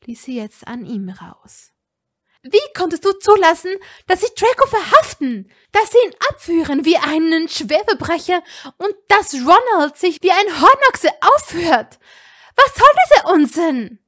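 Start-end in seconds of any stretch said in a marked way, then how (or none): 2.4-14.0 much higher and louder, raging; enraged shouting tirade; furious. A narrator's reading. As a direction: unload all the anger at him. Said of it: "Sie war wütend".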